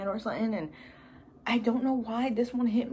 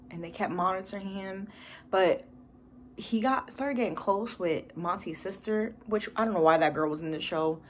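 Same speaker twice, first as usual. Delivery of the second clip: ordinary delivery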